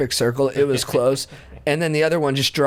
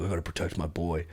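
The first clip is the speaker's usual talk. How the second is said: low, soft mock protective vow; mock tough; 7 semitones lower, 11 dB quieter than usual. Teen vowing to protect his buddy, low and mock tough. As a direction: growl it quietly like a bodyguard. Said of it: "I felt like".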